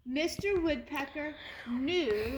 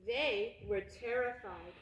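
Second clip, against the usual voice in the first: ordinary delivery, nothing marked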